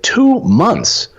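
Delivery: high, incredulous squeak; couldn't believe his ears; retelling his jaw-dropping shock at the two months; shocked disbelief. Retold or imitated. Shocked reply on plane.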